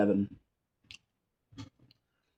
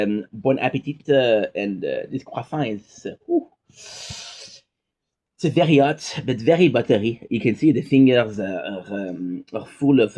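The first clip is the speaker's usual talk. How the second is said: put-on thick French accent; heavy French accent. Addressing viewers over croissants, heavy French accent. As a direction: lay the French accent on as heavily as possible. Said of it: "heaviest French accent I can".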